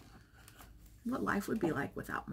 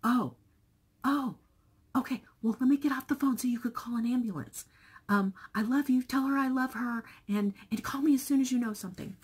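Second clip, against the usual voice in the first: higher, louder, fast flustered grandma; imitating grandma, high and quick; grandma hurrying; 5 semitones higher, 6 dB louder, faster than usual. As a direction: rush it as a worried old mother. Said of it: "And my mom was like".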